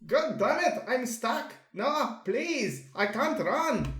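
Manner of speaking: acted panic. Dreamer stuck and unable to run, acted panicky plea.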